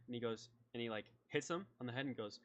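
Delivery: normal talking voice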